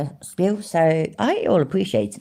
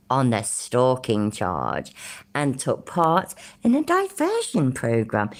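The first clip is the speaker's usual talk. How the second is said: slow, theatrical mock-posh reading; posh British voice; slower than usual. Streamer solemnly reading stalking charges from court record, mock posh British. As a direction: draw out each charge in put-on posh British. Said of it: "shall I try and do a posh voice".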